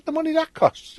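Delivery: high, broader Liverpool turn of phrase; home Scouse voice. Retold or imitated. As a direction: slip into the voice from back home. Repeats taxi line in home voice.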